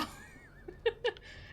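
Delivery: high, soft burst of giggles; light giggle. Real, not acted. Streamer joking about their own English, high giggle.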